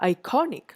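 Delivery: "iconic" with a high CON; i-CON-ic, weight on CON in "iconic"; penultimate stress. Shown as a demonstration. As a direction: hit the middle of "iconic". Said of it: "So, it is".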